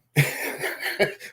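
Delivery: an airy chuckle; breathy laugh. Real, not acted. Laughing at own story.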